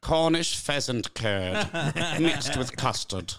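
slow, laughing posh-style mock; mock English. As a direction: savour it like a silly English dish. Mock English dish naming.

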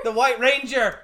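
high, loud proclamation; mock-excited reveal. Host echoing co-host's name for the hero, proclaimed loudly. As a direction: announce the hero with fanfare.

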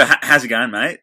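loud, casual Australian greeting; Australian accent. Shown as a demonstration. Host demonstrating for John, Australian accent. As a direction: show the Australian "how's it going, mate". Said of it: "crack at an Australian accent".

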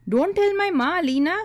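put-on scared boy begging her not to tell his mother; panicked. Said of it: "he said, sounding panicked".

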